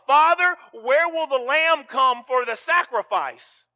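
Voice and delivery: put-on child Isaac. Child Isaac voiced asking father.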